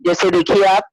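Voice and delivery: usual speaking voice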